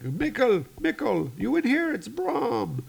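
high, anxious searching call; Brahm. Acted. Rescuer searching the radio room, high urgent calling. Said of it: "and he's like".

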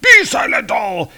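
high, loud booming order to silence the room; commanding shout. Acted. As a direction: bellow it like an angry god-king. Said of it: "He's like".

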